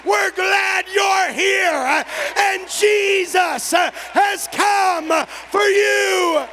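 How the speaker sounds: slow, loud, drawn-out welcome; welcoming greeting. Pretend. Church greeting the returning Thomas, slow and welcoming. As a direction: stretch each word out like open arms. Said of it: "We're gonna say".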